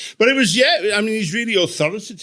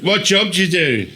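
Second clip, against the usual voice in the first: mock crowd work; 3 semitones higher, slower than usual. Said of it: "I'll do crowd work to invisible people".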